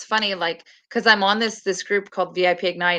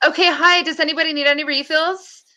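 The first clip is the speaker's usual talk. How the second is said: high, perky waitress voice; chirpy server; 7 semitones higher than usual. Retold or imitated. Server returning to tricked diners, put-on perky greeting. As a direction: pitch it up like a cheery server. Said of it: "drop their food and be like".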